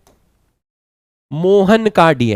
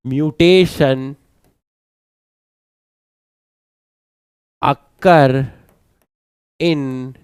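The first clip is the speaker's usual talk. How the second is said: every word spaced out slowly for notes; slow dictation; 5 semitones lower, 4 dB quieter, much slower than usual. Shown as a demonstration. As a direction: dictate slowly so students can write. (धीरे-धीरे लिखवाते हुए)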